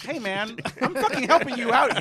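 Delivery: laughing mock reply.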